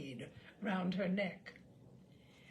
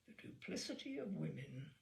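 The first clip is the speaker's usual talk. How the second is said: muttered under the breath, much softer; doctor grumbling to himself, muttered softly; 5 semitones lower, 9 dB quieter than usual. A narrator's reading. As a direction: mutter it aside, lower. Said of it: "the doctor muttered".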